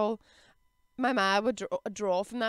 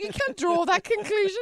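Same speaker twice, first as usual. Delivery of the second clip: laughing protest; 10 semitones higher, 6 dB louder than usual. Retold or imitated. Retold reply to ma, laughing.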